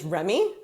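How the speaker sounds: Remy as "REM-ee" with an English R; English pronunciation of Remy. Shown as a demonstration. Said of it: "name in English is".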